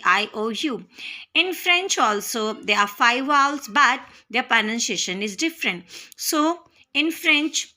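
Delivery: ordinary delivery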